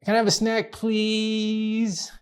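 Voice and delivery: put-on "please?" drawn out high and sweet like a little girl; wheedling please. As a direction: beg with a sugary stretched "please?". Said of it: "the tone and the emphasis on the word please".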